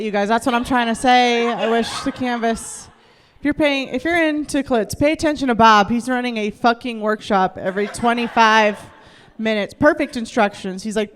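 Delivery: ordinary delivery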